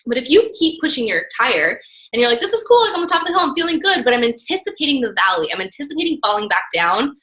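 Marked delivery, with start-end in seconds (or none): 2.4-4.0 pitched well up, light and breezy; playing the coach who thinks it's all easy; breezy mock joy, voice lifted high; carefree cheer. Imitating carefree coach at the top.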